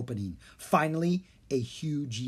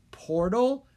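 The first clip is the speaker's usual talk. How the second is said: puzzled question; 8 semitones higher, 4 dB louder than usual. A narrator's reading. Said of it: "Drake asked".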